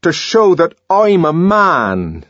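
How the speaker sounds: defiant proud ring; proud, resonant. A narrator's reading. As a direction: lift the chin and declare it. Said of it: "his voice suddenly took on a new resonance".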